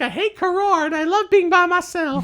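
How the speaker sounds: very high put-on accent; Janu. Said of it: "why I'm using that accent for Janu".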